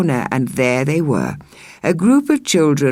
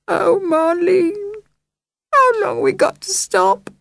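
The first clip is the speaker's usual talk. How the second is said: tearful whimper. Acted. Jill asks Marlene tearfully how long they must stay, high. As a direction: plead through sniffles. Crying little sister.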